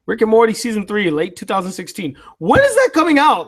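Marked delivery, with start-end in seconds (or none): 2.4-3.5 frustrated outburst. Real, not acted. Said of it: "I'm so mad".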